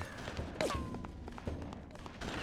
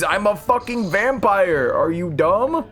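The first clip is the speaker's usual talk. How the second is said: shouting; 12 semitones higher, 6 dB louder, slower than usual. Real, not acted. Yelling at chat as vampire.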